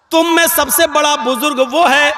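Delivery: normal talking voice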